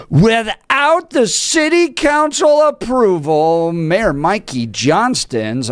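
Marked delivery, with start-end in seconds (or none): 0.1-3.7 higher, slow, outraged emphasis; theatrical disapproval; scandalized. Acted. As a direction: drag it out in mock shock. Scandalized reading of headline.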